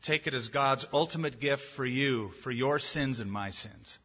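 ordinary talk

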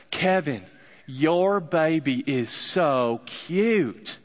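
slow, drawn-out Southern accent, imitating the girl; thick Southern accent. Tennessee girl complimenting the speaker's baby, thick Southern accent. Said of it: "in the thickest Southern accent I'd probably ever heard".

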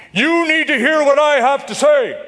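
high, loud demand to be heard. Shown as a demonstration. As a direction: turn the volume up to ten, as in an argument. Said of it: "raise your voice. You turn up the volume".

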